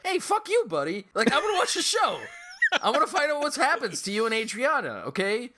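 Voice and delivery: put-on high, loud bratty kid talking back; cocky child inner voice. Young fan silently mouthing off at the actor, high and bratty. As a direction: play your nine-year-old self sassing the actor. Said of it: "That was me as a child thinking that".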